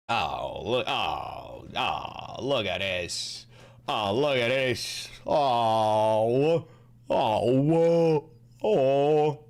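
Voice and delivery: low slow cooing; cooing at dog. Real, not acted.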